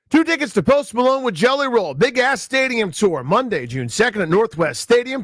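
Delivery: upbeat radio announcer read; punchy promo read for listeners; radio contest promo. Acted.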